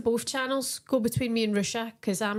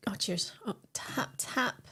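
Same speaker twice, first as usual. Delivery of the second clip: whispering; 7 dB quieter, slower than usual. Real, not acted. Quiet toast over the glass.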